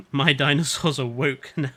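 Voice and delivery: laughing. Real, not acted. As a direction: say it while cracking up. Laughing at his own joke.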